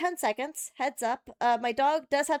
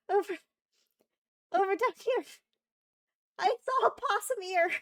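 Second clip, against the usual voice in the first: high, urgent squeal of discovery; excited spotting; 8 semitones higher than usual. Real, not acted. Streamer spots a possum ear on the animal cam, excited. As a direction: blurt it out in frantic high-pitched repeats.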